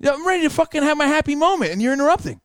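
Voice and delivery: high-pitched, indignant retort; worked up at being interrupted; angry protest. Retold or imitated. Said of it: "made me even more angry".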